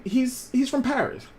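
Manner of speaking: ordinary delivery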